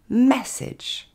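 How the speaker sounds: MESSAGE as "MESS-edge", MESS high and punched; high loud MESS, short falling "edge" in MESSAGE; stress on first syllable. Shown as a demonstration. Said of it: "L'accent tonique tombe sur le mais".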